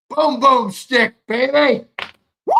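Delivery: loud high hype yell, slow and stretched; hyped shout. Real, not acted. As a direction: roar it like a hype man pumping up a crowd. Hyped after smelling salt.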